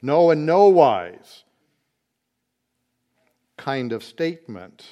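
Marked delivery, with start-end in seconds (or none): 0.0-1.4 NO and NO wise, louder and slow; drawn-out, loud No and no wise; emphatic no. Shown as a demonstration. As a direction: hammer each No in No and no wise. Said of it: "it's an emphatic".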